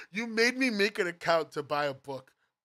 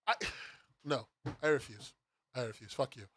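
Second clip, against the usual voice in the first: low, quiet, defeated refusal; so done; 8 semitones lower, 6 dB quieter than usual. Real, not acted. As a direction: give up on it under your breath. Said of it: "I'm actually so done".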